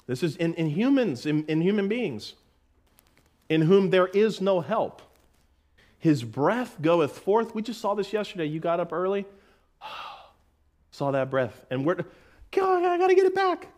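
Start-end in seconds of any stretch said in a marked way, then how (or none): 3.5-7.6 scripture reading. Shown as a demonstration. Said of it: "reading Psalm". 12.5-13.7 mock panic. Mock panic over breath.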